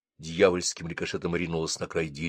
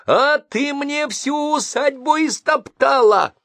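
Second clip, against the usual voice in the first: much higher, slow, loud shriek; 5 dB louder, slower than usual. Acted. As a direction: scream from the first word. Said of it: "закричала с места в карьер".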